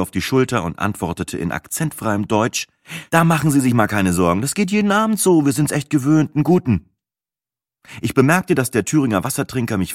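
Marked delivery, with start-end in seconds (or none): 3.1-6.9 higher-pitched, breezy waitress voice; voiced waitress, light and high; reassuring waitress. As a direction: brush off the apology cheerfully. Waitress reassures narrator in German.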